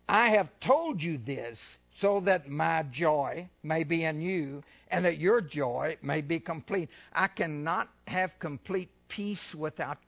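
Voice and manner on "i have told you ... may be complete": preaching scripture. Acted. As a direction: lay the verse out deliberately. Reading scripture aloud.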